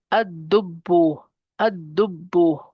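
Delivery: slow, deliberate Ad-dubbu with held B; doubled consonants. Shown as a demonstration. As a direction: lean on both doubled sounds in Ad-dubbu. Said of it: "mushaddad has two bas".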